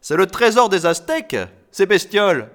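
loud, high, mocking delight; overjoyed jeer at high pitch; gleeful stamping excitement. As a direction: exclaim it in wild glee. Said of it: "trépigna Ungar".

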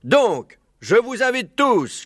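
high, measured proclamation; inviting fox orator. Acted. Maître Renard inviting all the animals, orating.

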